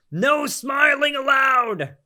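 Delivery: high, drawn-out, hammed-up yell; mock outraged shout. YouTuber mocking a pundit's outrage, hammed-up shout. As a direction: holler it like an absurd ban.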